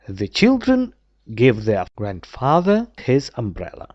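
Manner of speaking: ordinary delivery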